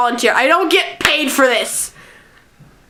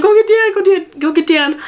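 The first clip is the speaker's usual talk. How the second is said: higher, urgent imitation of pleading; retold tearful plea; 7 semitones higher than usual. Upset child begging for a friend to be fetched, urgent retold plea. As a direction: play a mock-upset plea for help. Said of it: "I bawled my eyes out".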